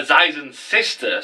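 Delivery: ordinary talk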